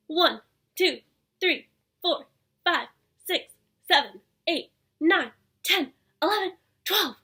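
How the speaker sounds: loud, high counting along with hops; excited counting. Acted.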